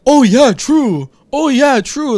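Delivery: high, slow, empty-headed "oh yeah true"; dim mock agreement. Mocking characters who accept anything.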